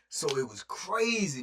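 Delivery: ordinary talk, nothing marked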